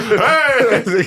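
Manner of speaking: high-pitched hey, playfully called out; hammed-up holler across the room; mock hollered greeting. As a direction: echo an over-eager greeter. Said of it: "had to scream from across".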